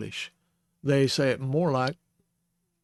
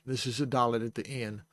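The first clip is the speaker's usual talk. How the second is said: ordinary talk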